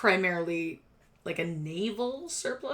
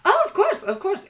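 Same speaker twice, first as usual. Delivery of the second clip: eager agreement; 12 semitones higher, 7 dB louder than usual. Acted. Rat boy eagerly agrees to sell tickets.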